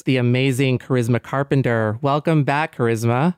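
ordinary talk, nothing marked

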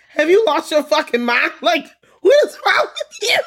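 high, loud shriek of disbelief; outraged shouting. Acted. Player berating whoever put blackface on the game, shrieking outrage. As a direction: shout it through laughter, scandalized.

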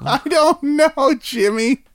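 high, slow incredulous whine; shocked disbelief. Real, not acted. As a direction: drawl it in disbelief.

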